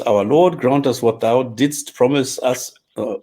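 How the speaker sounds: normal talking voice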